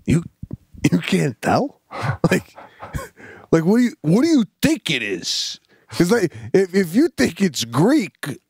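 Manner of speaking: high-pitched incredulous comeback; imagined retort. Retold or imitated. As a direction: fire back in mild disbelief, pitch climbing. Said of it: "in my head, I would go".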